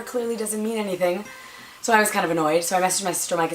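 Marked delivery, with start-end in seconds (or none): none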